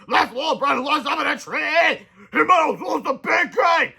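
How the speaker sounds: slow, high, loud jock shout; shouting team. A narrator's reading. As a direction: yell as a crowd of jocks. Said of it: "cried the entire football team".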